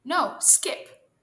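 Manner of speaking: loud, high correction; shouting. A narrator's reading. Squirrel contradicting rabbit's advice, shouting. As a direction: shout over a rival coach. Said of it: "shouted Squirrel".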